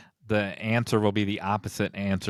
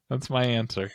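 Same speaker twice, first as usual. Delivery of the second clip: ordinary talk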